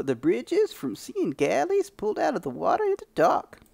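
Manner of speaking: put-on high, grizzled old prospector; old man prospector voice. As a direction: play a crusty old prospector. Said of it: "doing a freaking old man prospector voice".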